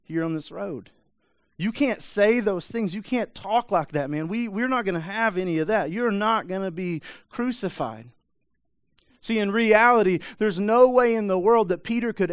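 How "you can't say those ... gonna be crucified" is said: high, heated scolding; fussing. Retold or imitated. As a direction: rebuke him like a worried friend. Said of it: "literally fussing at him".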